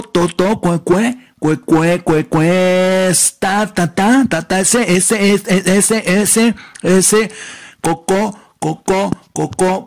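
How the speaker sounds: mock a nasal man who stutters on every word; nasal stutterer. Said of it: "gangoso y tartamudo". (imitación de tartamudo gangoso, voz aguda)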